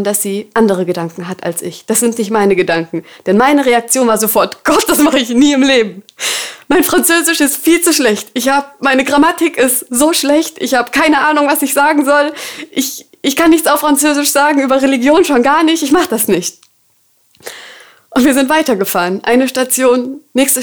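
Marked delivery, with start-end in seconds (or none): 4.6-16.6 panicked protest. Retold or imitated. Panicked refusal to God.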